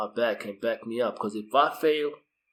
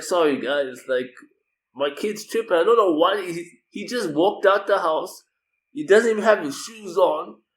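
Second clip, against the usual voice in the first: flustered apology; 6 semitones higher, 5 dB louder than usual. Retold or imitated. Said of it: "Now I'm just like".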